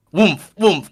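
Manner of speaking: loud booming woomph sounds; mock coffin thuds; coffin thud noise. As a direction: thump it out like a heavy coffin hopping. Coffin sound effect bursting in.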